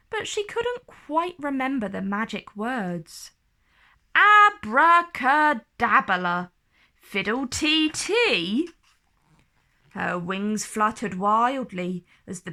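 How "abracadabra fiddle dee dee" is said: loud, slow fumbling incantation; muddled spell. A narrator's reading. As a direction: grandly get the words wrong.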